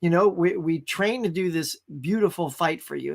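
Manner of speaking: usual speaking voice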